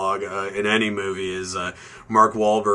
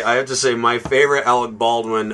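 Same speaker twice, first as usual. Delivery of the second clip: ordinary talk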